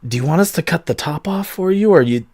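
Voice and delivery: usual speaking voice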